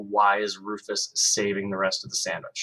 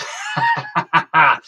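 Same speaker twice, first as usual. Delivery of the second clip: high, loud giggle; little kid laugh; 6 semitones higher, 8 dB louder than usual. A narrator's reading. Little brother laughing at the squirrel, high loud giggle. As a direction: burst out giggling. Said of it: "Cody laughed".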